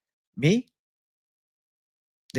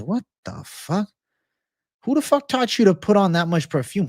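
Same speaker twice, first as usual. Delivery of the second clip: indignant outburst; 8 semitones higher than usual. Pretend. Imagined rant at perfumed girl.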